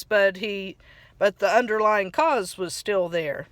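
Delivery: ordinary delivery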